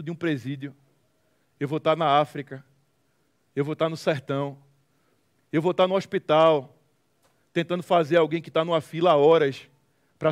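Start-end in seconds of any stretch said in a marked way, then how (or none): none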